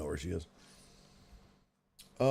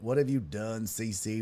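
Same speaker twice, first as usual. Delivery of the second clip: quieter, restrained mock reproach; understated "what have you done"; low-key dismay; 3 semitones lower, 5 dB quieter than usual. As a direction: say it half to yourself in dismay. First take of mock reproach.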